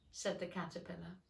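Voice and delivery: ordinary delivery, nothing marked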